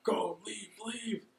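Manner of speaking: high, soft frantic urging, rising on the last leave; mock desperate plea to run; a hint of a panicked woman begging; urgent plea. Casca urged to flee.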